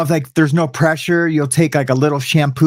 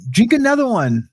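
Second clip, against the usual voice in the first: higher, matter-of-fact cellmate voice; lightly voiced cellmate telling him what to do; imitating the older cellmate's calm command; cellmate's advice; 8 semitones higher than usual. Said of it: "He's like".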